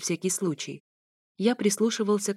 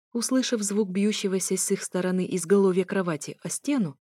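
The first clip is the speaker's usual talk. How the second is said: ordinary delivery, nothing marked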